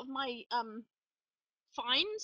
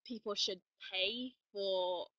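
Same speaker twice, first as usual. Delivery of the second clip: ordinary talk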